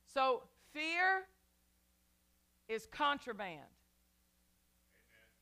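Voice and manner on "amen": usual speaking voice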